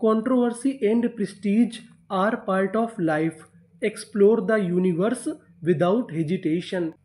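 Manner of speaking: very slow, word by word; teacher reading the full sentence at dictation pace; slow reading aloud. Shown as a demonstration. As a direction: read it out slowly for the class. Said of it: "तो हमारा पूरा sentence क्या बना?".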